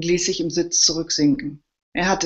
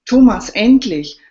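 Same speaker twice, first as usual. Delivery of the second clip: loud, higher relieved call; 5 semitones higher, 10 dB louder than usual. A narrator's reading. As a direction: call out to the arriving chief. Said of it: "rief Daniel Teuwitz".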